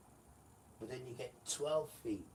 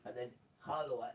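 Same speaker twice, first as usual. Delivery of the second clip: usual speaking voice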